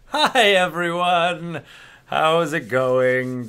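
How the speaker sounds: big, slow, sing-song greeting; exaggerated cartoon intonation. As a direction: stretch the hello like a cartoon host. Stream host's drawn-out opening greeting.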